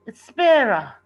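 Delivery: loud, high call after him; yelling. A narrator's reading. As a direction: shout to stop him leaving. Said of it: "Renita yells".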